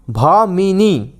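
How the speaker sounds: Bhamini stretched out slowly, syllable by syllable; slowly drawn out. Shown as a demonstration. Teacher repeats Bhamini very slowly for students to memorise.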